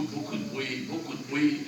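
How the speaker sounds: ordinary delivery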